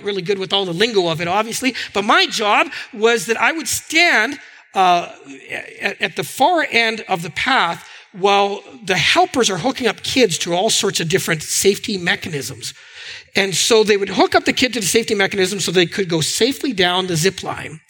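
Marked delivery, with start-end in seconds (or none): none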